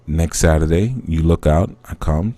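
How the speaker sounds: usual speaking voice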